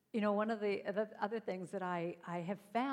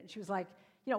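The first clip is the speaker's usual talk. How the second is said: ordinary talk, nothing marked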